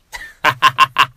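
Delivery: bursting out laughing at his own joke; a big, loud laugh. Real, not acted.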